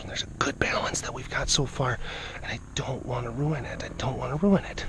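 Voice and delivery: hushed whispering aside; whispered explanation so the tigers stay calm. Real, not acted. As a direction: keep it whispered, close and confidential.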